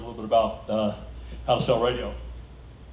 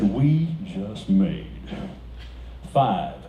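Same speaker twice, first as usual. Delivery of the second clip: slow, awed, dramatic; slowly telling wife about the windfall; stunned announcement; 2 semitones lower, much slower than usual. Retold or imitated. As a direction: let it sink in.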